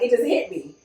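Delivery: normal talking voice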